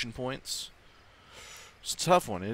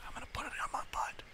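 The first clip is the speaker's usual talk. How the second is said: sexy whisper; 9 dB quieter, much faster than usual. Pretend. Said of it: "I'm gonna use that later to get sexy".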